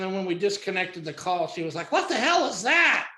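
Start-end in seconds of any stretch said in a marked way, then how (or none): 1.9-3.1 high, incredulous woman caller; mock indignation, pitched way up; indignant disbelief. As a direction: imitate an outraged demand for answers. Caller indignant after disconnected call.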